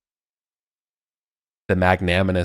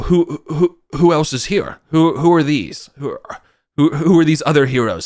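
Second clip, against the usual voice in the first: eager king; 7 semitones higher, 4 dB louder than usual. Acted. King eagerly asks about heroes.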